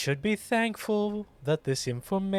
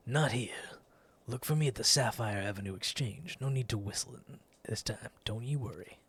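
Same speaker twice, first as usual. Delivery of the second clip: hushed conspiratorial; 5 semitones lower, 6 dB quieter, faster than usual. Acted. Conspiratorial character line.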